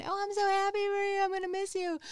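bright forced cheer to a friend who's moving away; fake cheerful friend. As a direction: sell the brave supportive-friend act. Acting supportive to leaving friend.

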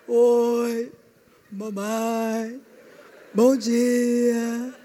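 low, slow dragged greeting; mock a sluggish Teletubby; mocking a calm spouse's sleepy greeting; sleepy drawl.

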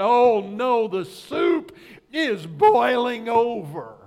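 loud, slow cry of alarm; startled cry that the soup is boiling over; alarmed shout. Retold or imitated. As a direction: yell it like the pot just boiled over. Panicked thought at boiling pot.

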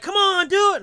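high, loud coaxing; celebrity pushing the speaker to come over, loud; high pushy coaxing. Retold or imitated. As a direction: nag him into it. Kato pushing him.

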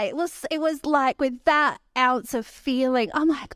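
ordinary delivery

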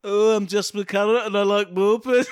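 put-on failed Birmingham accent sliding into Scouse. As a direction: have a bad go at Brummie. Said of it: "trying to be Birmingham".

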